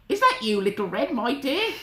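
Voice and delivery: put-on high old-lady call; calling Granny. Granny calling to the door, high questioning voice. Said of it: "called Granny".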